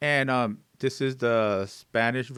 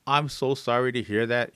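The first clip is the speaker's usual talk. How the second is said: ordinary delivery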